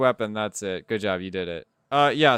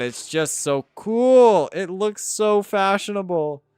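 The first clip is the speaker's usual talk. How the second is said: higher, louder, slow gushing over it; 7 semitones higher, 6 dB louder, slower than usual. Real, not acted. Streamer showing chat her weapon, slow and gushing. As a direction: drag out the praise like a fan.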